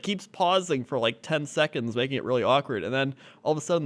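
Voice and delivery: ordinary talk, nothing marked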